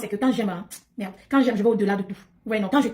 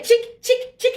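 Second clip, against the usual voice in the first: mock tchic sounds; 13 semitones higher, 4 dB louder, slower than usual. Mimics marabout ritual noise.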